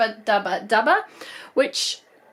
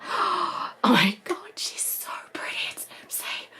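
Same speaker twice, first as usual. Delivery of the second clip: gasping whisper; 6 dB quieter than usual. Real, not acted. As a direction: barely breathe the words in wonder. Unboxing a beloved doll.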